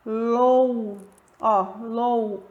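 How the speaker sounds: "Lou" isolated, the O said strong and open; syllable demo. Shown as a demonstration. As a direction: pull out "Lou" as its own syllable. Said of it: "Olha só o som do O".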